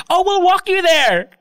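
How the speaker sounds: hammed-up clingy escort; mock eager workers. Workers offering to walk him to Glorieta, slow mock eagerness.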